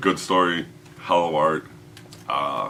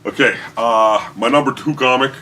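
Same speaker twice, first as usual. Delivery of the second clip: stretched-out host announcement; hyped announcement; 7 semitones higher, 10 dB louder than usual. Real, not acted. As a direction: drag it out for the big reveal. Announcing the next countdown entry.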